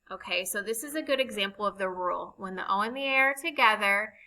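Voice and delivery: ordinary delivery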